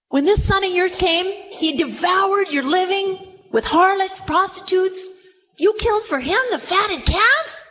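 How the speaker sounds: high, incredulous protest building to the question; indignant elder son. Acted. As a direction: accuse your father in acted outrage. Elder son accuses his father.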